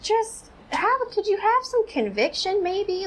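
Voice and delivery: high-pitched, incredulous appeal; exasperated plea. Real, not acted. Vlogger telling the brother to have conviction, exasperated plea.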